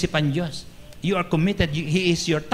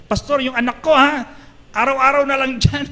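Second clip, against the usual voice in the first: higher, louder mock complaint from an upset mother; angry mother complaining; 10 semitones higher, 5 dB louder than usual. Upset mother confronting the pastor about her child, mock angry. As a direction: play the irate mom scolding the pastor, half-comic. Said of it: "nagagalit na po sa akin".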